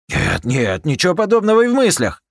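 high, loud and fast protest; panicked denial. Acted. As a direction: deny it in a panic. Captain desperately denies.

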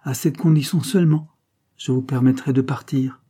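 loud, high, brazen command; impudent. A narrator's reading. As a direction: lay down the terms with bold insolence. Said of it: "répliqua impudemment Carmela". (ton impudent et impérieux, voix haute et forte)

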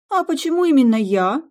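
high, loud hurt protest; offended indignation. Acted. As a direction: bristle at the blame. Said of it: "обиженно возмутилась Сьюзи".

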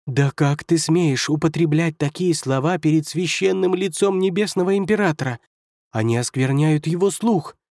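high-pitched indignant scolding; scandalized outrage. Acted. First counselor scolding demon for coarse words, outraged.

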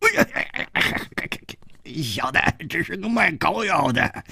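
high, pained peddler voice on 楚留香; grimacing grin. Acted. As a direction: squeal 楚留香 through the pain of a gripped arm. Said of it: "他咧着嘴笑着说".

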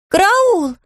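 high startled cry; alarmed cry. Acted. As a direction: yelp in alarm. Judy sees drop, cries out.

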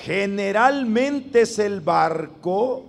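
slow, firm phrase for the congregation to echo; slow dictation. Shown as a demonstration. Preacher dictating a saying for the youth to repeat, slow and firm. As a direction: lay it out phrase by phrase, strong. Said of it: "repitan lo que voy a decir, pero fuerte".

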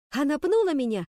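high, indignant cry; yelled complaint. A narrator's reading. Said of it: "завопила я в ответ". (возмущённый вопль)

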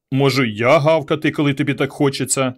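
usual speaking voice

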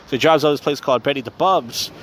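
normal talking voice

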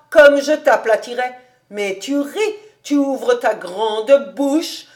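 loud, exasperated rant; heated, emphatic old rabbi; indignant. A narrator's reading. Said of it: "tout pâle d'indignation".

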